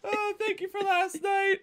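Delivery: high, soft put-on girlfriend. Streamer voicing the elf girlfriend, cooing. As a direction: coo it like a shy lover.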